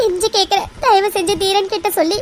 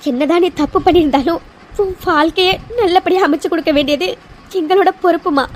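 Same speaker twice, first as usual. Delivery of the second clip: ordinary delivery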